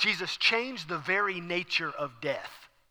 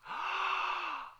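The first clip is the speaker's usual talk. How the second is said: long, high, soft gasp; amazed gasp; 13 semitones higher, 9 dB quieter than usual. Acted. Women seeing Jesus alive, long amazed gasp. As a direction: suck in air in astonishment. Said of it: "their faces explode".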